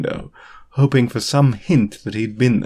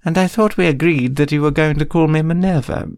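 higher, arch teacher voice; prim McGonagall; 6 semitones higher than usual. A narrator's reading. McGonagall to Remus about her name, arch higher voice. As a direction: chide him lightly.

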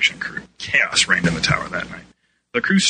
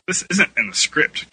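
higher-pitched protest; arguing; 7 semitones higher than usual. A narrator's reading. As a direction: object as if in an argument. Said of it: "Keza argued".

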